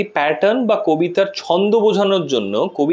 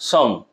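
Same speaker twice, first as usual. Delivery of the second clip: teacher's demo of a stressed sum; stressed syllable; 6 semitones lower than usual. Shown as a demonstration. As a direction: sum said alone with emphasis. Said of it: "জোর দিতে হচ্ছে সামে".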